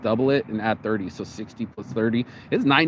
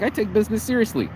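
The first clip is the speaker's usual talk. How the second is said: raised-pitch, defensive retort; indignant insistence; 8 semitones higher than usual. Retold or imitated. Retelling his answer to the joking girl, indignant. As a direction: insist on it in a higher voice.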